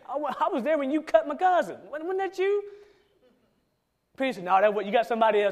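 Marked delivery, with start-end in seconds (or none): 0.1-2.6 higher mock woman's voice, laughing; mock accusing woman. Servant woman accusing Peter of cutting her cousin, high laughing mock voice. 4.4-5.5 fast, louder rushed denial; flustered denial. Retold or imitated. Peter brushing off the servant woman, fast loud flustered denial.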